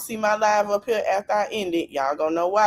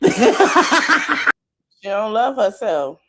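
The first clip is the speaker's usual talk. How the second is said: laughing slowly; 5 dB louder, much slower than usual. Real, not acted. Host repeats punchline.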